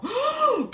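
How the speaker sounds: high, exaggerated gasp of shock; mock horrified gasp. Imitating officers seeing the broken TV, mock shocked gasp. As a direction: gasp as if it were your own disaster. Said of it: "act like it's their T V in their home".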